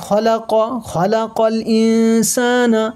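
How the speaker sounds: খলাক keeps its last vowel and flows into খলাকল ইনসানা; slowly chanted link with a held hum in ইনসানা; joined reading. Shown as a demonstration. Quran teacher showing reading without a pause, joined reading. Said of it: "যদি মিলিয়ে পড়েন".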